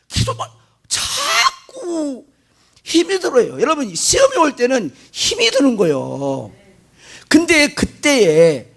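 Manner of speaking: ordinary talk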